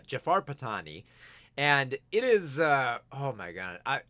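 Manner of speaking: usual speaking voice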